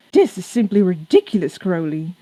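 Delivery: flustered outburst. Acted. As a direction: splutter in scandalised shock.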